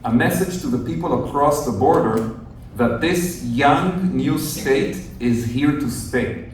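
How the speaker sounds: normal talking voice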